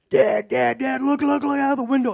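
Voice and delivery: high, loud, urgent call to Dad; freaking-out son. Retold or imitated. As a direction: imitate an excited son urgently getting his father's attention. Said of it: "my son's freaking out".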